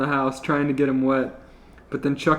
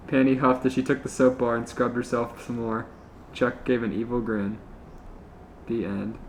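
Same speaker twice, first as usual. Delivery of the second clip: plain reading voice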